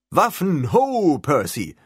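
loud, slow call across the hall; shouting. Acted. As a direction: throw the words at Percy, shouting. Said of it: "rief er".

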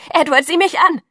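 high, loud call of his name; a frantic call across the square; pleading shout. Acted. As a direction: shout so he finally looks at her. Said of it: "schrie ich".

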